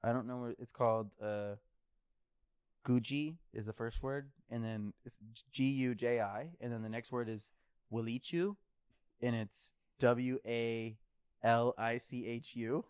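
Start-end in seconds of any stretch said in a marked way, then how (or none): 5.5-12.8 letters spaced out slowly; slow spelling out. Real, not acted. Reading the coffee bag label aloud, slow and deliberate spelling. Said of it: "spelling out coffee name".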